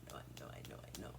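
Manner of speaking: fading into an unvoiced whisper of "I know"; whispered to herself. Real, not acted. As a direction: let "I know" die away into a breathy whisper. Repeating herself, trailing off.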